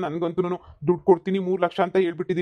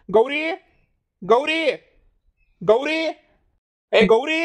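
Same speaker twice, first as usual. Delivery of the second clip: higher, louder repeated calls with a rising end; calling out; 7 semitones higher, 4 dB louder, much slower than usual. Acted. Husband calling his wife Gauri about the missing money, loud calls. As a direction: call her name across the house.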